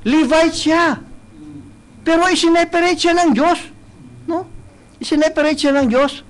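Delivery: impassioned pulpit delivery; preaching. Acted. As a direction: preach it out to the congregation. Preaching continues at full force.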